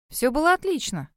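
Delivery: lightly insistent reassurance; reassuring. A narrator's reading. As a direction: assure them everything is fine. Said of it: "заверила я".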